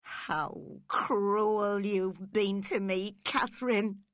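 loud, drawn-out accusation; slow, loud anguished outburst; wild cry. A narrator's reading. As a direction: cry out wildly at the one who hurt you. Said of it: "he cried wildly".

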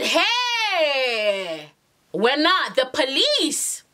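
long drawn-out mock wail, then slow words; shocked outcry. Woman reacting to police on the phone, shocked outcry. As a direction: stretch it out in comic alarm.

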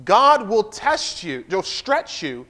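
very slow, each word weighted; slow emphatic repeat. Shown as a demonstration. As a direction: drag it out so it lands. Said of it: "I'm gonna say it again".

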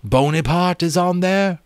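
high, astonished question; surprised question. A narrator's reading. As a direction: dragon eagerly checking. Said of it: "Temeraire called back".

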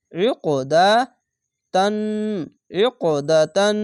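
ইক দা তান with bouncing q and long hummed n; qalqala and ghunna. Shown as a demonstration. Teacher models reading for learners, echoing q, nasal held n. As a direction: squeeze ইক, bounce it, hum out তান. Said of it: "এভাবে উচ্চারণ করবেন".

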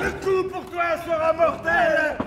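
menacing shout. Acted. Gambler threatens his card opponent.

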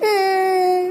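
a put-on whimpering sob; fake sob. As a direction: squeeze out a fake cry. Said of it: "அப்படியே continue பண்ணு". (போலி அழுகை விம்மல்)

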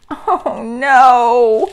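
high, loud horrified "oh no"; gasping dismay. A narrator's reading. Mother finds the tickets missing, aghast cry. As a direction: swell into a storybook gasp of dismay. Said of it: "Ava's mother gasped".

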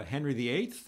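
normal talking voice

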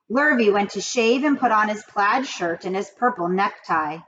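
ordinary delivery

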